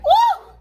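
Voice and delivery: loud, very high "woo"; high cheering whoop. Retold or imitated. Fan cheering at the concert, high loud whoop. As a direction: whoop it out at the top of your pitch.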